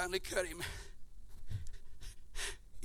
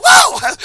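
loud, much higher joyful whoop; ecstatic whoop; 10 dB louder than usual. Real, not acted. Preacher shouting at the devil in full fervour, ecstatic whoop. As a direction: cry out in spiritual ecstasy.

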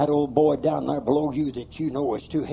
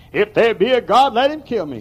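mocking scoffer; 7 semitones higher, 8 dB louder than usual. Preacher mocks an unbeliever.

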